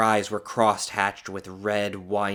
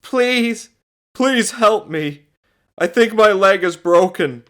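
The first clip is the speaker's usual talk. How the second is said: high, loud, slow pleading; pained cry; 12 semitones higher, 12 dB louder, slower than usual. A narrator's reading. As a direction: cry for help in agony. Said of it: "cried John, grimacing".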